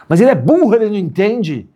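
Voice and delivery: high-pitched exasperated objection; mock protest. Student objecting to respecting someone, high exasperated protest. Acted objection to the teacher's advice.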